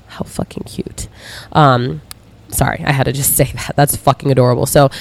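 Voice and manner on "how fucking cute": soft gushing whisper. Real, not acted. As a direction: breathe it like a secret delight. Gushing aside while reading message.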